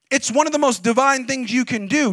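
ordinary talk, nothing marked

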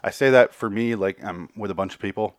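ordinary talk

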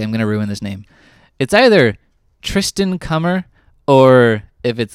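ordinary talk, nothing marked

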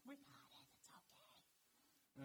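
high whispered reassurance, trailing off slowly; whispering kids. Retold or imitated. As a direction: whisper it back like a kid trying to be quiet. Boys reply in whisper.

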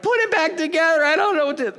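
high-pitched upset child imitation; imitated child's high, frantic plea; mock screaming kid. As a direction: wail it like a kid in meltdown. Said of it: "they're screaming, they're like".

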